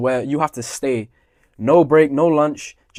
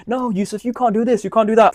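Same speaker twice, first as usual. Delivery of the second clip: higher-pitched mock teacher telling-off; imitating scolding teacher; 7 semitones higher than usual. Teacher telling Yusuf what he can't do, mock scolding.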